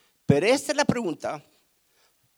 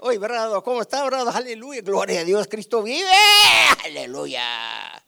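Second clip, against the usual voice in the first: hammed-up fake-holy church greeting, higher and louder; mock churchy greeting; 3 semitones higher, 8 dB louder than usual. Preacher mocking a hypocrite's showy church greeting. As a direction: mimic a hypocrite gushing praise at the door.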